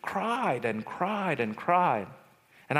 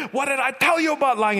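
high, fast angry scolding; retold yell, quick and pitched up; father yelling; 8 semitones higher, 4 dB louder, faster than usual. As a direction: rail at the kids like an angry dad. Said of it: "he started yelling".

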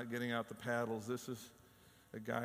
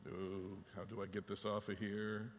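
embarrassed mutter; 6 semitones lower, 7 dB quieter than usual. Retold or imitated. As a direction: lower and softer, sheepish. Embarrassed sharer deleting post.

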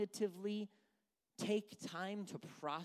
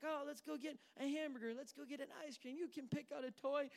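higher-pitched mock treat-offering voice; upbeat parent; 6 semitones higher than usual. Parent offering kids burgers and ice cream, playfully upbeat.

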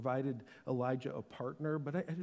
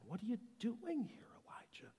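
breath-soft, raised, searching questions; gentle whisper; 7 semitones higher, 10 dB quieter than usual. Acted. Voice of God asks Elijah again, whispering gently. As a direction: whisper it gently, as God's still voice. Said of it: "there was a gentle whisper".

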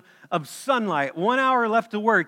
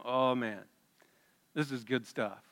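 smug murmur; 6 semitones lower, 8 dB quieter than usual. Pretend. Workers' smug private thought.

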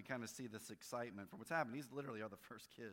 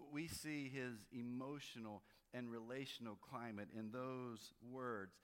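ordinary talk, nothing marked

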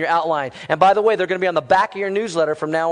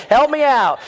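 ordinary delivery

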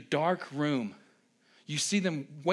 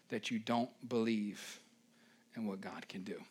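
dropping to a hush; 7 semitones lower, 10 dB quieter than usual. Real, not acted. Voice drops for emphasis.

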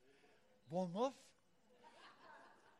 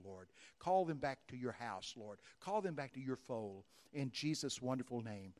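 steady, measured pastoral prayer; earnest closing prayer; 4 semitones lower than usual. Real, not acted. Pastor praying blessing over the people, steady and earnest. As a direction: pray over them calmly and earnestly.

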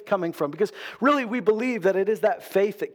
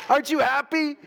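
mock eager believer; 7 semitones higher than usual.

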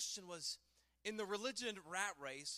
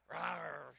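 dinosaur roar; 5 semitones lower than usual. Pretend. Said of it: "give me your best growl".

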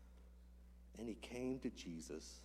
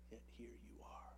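hushed; 3 semitones lower, 14 dB quieter than usual. Real, not acted. Altar call, hushed intimate appeal.

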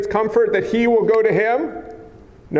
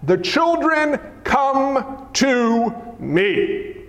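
slow, weighted command; measured indignation, word by word; indignant Jesus; much slower than usual. Acted. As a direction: draw each word out in stern rebuke. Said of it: "Indignant means angry".

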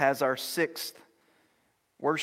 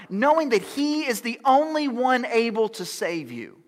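louder, higher preaching build; full-force preaching; 9 semitones higher, 10 dB louder than usual. Acted. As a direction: rise up and challenge them.

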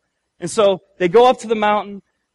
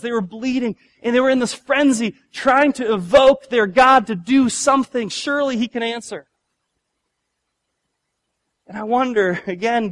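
usual speaking voice

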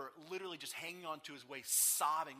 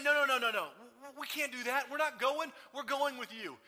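tearful refusal; 8 semitones higher, 8 dB louder than usual. Acted. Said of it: "But they say".

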